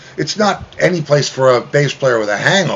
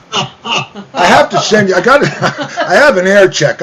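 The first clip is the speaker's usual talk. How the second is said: laughing; 6 semitones higher, 4 dB louder than usual. Real, not acted. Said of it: "laughing while talking".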